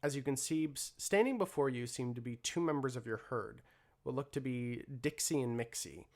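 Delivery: usual speaking voice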